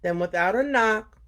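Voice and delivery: put-on lively read-aloud for kids; storytelling voice for a young audience; story reading. A narrator's reading. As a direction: tell it like story time for children. Reads story to children.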